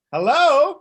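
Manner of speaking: high, loud whale-like hello; whale call. Pretend. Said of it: "do your best whale call for me".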